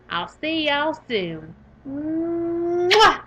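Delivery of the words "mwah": loud smacking mwah, kissed toward the viewers; a loud kiss blown to the camera. Real, not acted. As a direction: blow a big kiss to the audience. Blowing a kiss goodbye.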